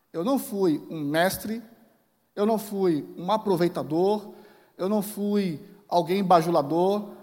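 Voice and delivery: Paul pleading his case, pressing every não; loud, slow declaration of innocence; emphatic apostle. Acted. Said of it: "Paulo personifica a maneira como ele atuou".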